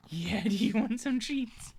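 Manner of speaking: high, slow, laughing offer of treats; pet talk. Real, not acted. Streamer offering her dog treats, high, slow, laughing.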